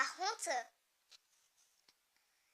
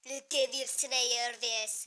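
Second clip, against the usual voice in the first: English accent; 2 semitones lower, 7 dB louder, slower than usual. Shown as a demonstration. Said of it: "anglais est totalement nul".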